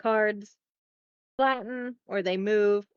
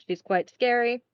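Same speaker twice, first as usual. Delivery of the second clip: ordinary delivery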